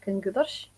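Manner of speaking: "كنقدرش" low-key, with g in place of q; Amazigh pronunciation. Shown as a demonstration. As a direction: say "كنقدرش" quietly as kan-gudersh. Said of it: "Amazigh pronunciation".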